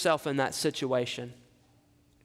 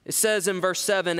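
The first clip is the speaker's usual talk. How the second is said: ordinary talk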